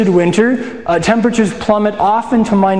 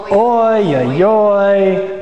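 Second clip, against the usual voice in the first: grandmother's lament; much slower than usual. Retold or imitated. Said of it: "my baba ... she says".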